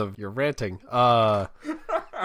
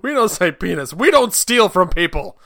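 loud, raised-pitch comic outrage; mock scolding dad; 6 semitones higher, 8 dB louder than usual. Father telling son off for stealing friend's joke, mock angry. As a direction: lecture him in hammed-up fatherly indignation.

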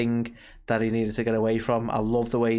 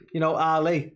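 imitated Scouse accent; 4 semitones higher than usual. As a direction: mimic a Scouse "Ali", higher. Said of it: "with a Scouse accent".

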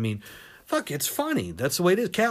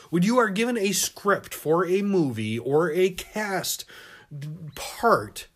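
ordinary delivery

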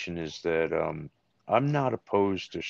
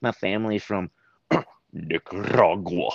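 put-on teasing Texas accent; mock Texas accent; 3 semitones higher, 4 dB louder than usual. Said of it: "and this is my Texas accent".

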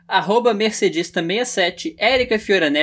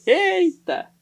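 surprised exclamation; 12 semitones higher than usual. Real, not acted. Reacting to own pun.

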